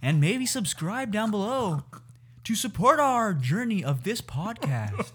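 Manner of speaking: high, lilting podcast sign-off; sing-song announcer patter; radio host impression. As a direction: gush like a cheesy broadcast host. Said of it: "you gotta do that again".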